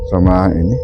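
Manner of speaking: usual speaking voice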